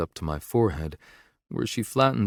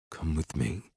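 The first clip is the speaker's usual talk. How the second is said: low, soft possessive growl; 4 semitones lower, 8 dB quieter than usual. A narrator's reading. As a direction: growl it quietly in her ear. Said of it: "I growl softly".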